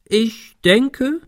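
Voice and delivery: high, theatrically drawn-out opening; exaggerated. A narrator's reading. Ben teases Kim with his answer, exaggerated. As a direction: overdo it like a mock-solemn announcement. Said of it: "fuhr er übertrieben fort".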